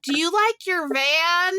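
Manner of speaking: lightly voiced stranger repeating herself; imitating a friendly stranger. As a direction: ask again, slowly and patiently. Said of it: "She goes".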